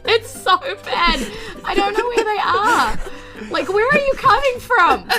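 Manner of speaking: ordinary delivery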